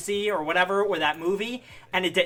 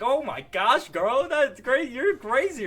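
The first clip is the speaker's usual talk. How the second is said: higher, slow sassy gush; mock gushing; 8 semitones higher, 3 dB louder, slower than usual. Vlogger imitating Deadpool mocking Johnny, high sassy. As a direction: drag it out with camp sarcasm. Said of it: "he was like".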